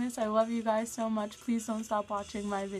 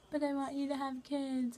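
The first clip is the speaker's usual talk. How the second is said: higher pleading mom voice; a hint of a worried mother; imitating mom; 7 semitones higher than usual. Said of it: "she was like".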